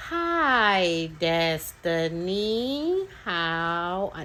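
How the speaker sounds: very slow, stretched greeting; exaggerated turtle talk. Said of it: "like, really exaggerate it".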